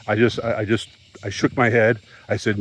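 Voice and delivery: usual speaking voice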